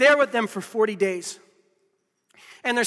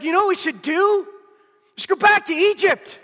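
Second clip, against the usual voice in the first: higher, louder hammed-up plea to go back; exasperated mock cry; 9 semitones higher, 6 dB louder than usual. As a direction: cry it out like a fed-up crowd. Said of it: "they're just like".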